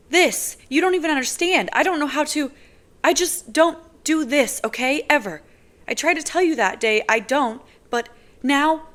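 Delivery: words tumbling out in frustrated fury; fury breaking through. Acted. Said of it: "fury edging in her voice".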